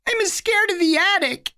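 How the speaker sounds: put-on high, small child's voice; a scared little kid, pitched high; little boy voice. A narrator's reading. Little Eddie pleading with his big brother, high childlike voice.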